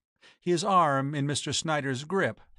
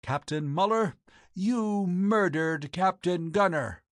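slow, ringing accusation, pitched up; cold and biting; 5 semitones higher, slower than usual. A narrator's reading. Landlady accuses the captain of murder, cold biting ringing voice. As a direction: accuse him coldly, drawing out each word. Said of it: "voice rang through the room, cold and biting".

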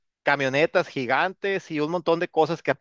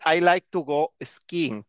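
slow, clear teacher example; slow model sentence; much slower than usual. Shown as a demonstration. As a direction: stretch "like" slowly with a falling end. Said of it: "Por ejemplo, aquí dice".

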